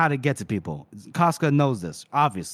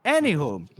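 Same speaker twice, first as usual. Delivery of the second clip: ordinary talk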